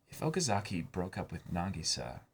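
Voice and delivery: soft, hushed voice, as if thinking to himself; hushed inner thought. Retold or imitated. Side character secretly wondering about his chance with Nagisa, hushed. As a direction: murmur it like a secret hope. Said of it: "probably dreamt or wondered".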